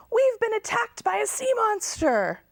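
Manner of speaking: high, panicked yell. A narrator's reading. Girls running onto the beach, yelling in panic. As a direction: shout the alarm.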